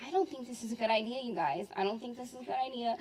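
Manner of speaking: quick, hushed, nervous "I don't think this is a good idea"; worried hushed warning. Retold or imitated. Cautious friend warns the others while sneaking out, hushed and fast. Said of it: "Rayanne would be the one".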